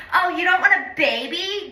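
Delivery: high, mocking mean-girl imitation; Valley Girl voice. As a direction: mimic a fake Valley Girl's nosy disbelief. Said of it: "this Valley Girl voice".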